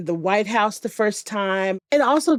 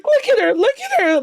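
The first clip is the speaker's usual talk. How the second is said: much higher, louder, thrilled squeal; excited squeal; 14 semitones higher, 5 dB louder than usual. Retold or imitated. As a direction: gush like a starstruck fan. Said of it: "I was like".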